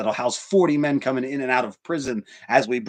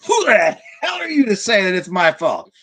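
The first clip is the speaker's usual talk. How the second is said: a hammed-up, louder, higher outburst of an imagined critic; mock indignant listener; 9 semitones higher, 8 dB louder than usual. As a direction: play the offended listener shouting back at you. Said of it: "I know what you're gonna say".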